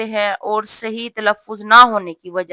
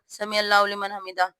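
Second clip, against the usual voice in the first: softer mock rush through سمع اللہ لمن حمدہ; hurried wrong way; 7 dB quieter than usual. Shown as a demonstration. Teacher imitating the rushed wrong recitation, softer and quick. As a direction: gabble it as careless people do. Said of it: "ایسے جلدی جلدی نہیں پڑھنا".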